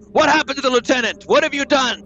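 hammed-up furious demand; hammy stage actor. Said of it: "and I say".

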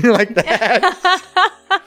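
laughing through the words. Real, not acted. Dad laughing at Ariel's whisper, amused.

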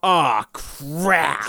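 put-on high, loud little-kid yelp of annoyance; little kid cursing. Four-year-old cousin at the drive-thru gets the wrong sauce, high loud kid yelp. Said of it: "He goes".

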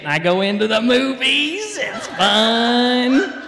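high, whooping Southern holler; loud Southern accent. Shown as a demonstration. Telling kids how movie lines go, high loud Southern accent. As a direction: swoop up into a loud Southern twang. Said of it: "talking real loud in my Southern accent".

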